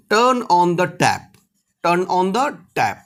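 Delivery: slow, careful and even; slow model phrase. Shown as a demonstration. Teacher slowly models English for Hindi learners. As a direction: drill it slowly twice. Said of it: "teacher models English phrase".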